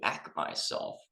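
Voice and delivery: soft breathy whisper of the key phrase. Acted. Host teasing the video's topic to viewers, whispered. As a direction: whisper it like a secret hook. Teaser opening, whispered hook.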